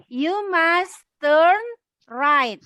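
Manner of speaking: slow teacher demo to repeat; slow model line; slow model reading. Shown as a demonstration. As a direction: say it slowly for them to copy. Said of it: "Yuk ikutin [name]".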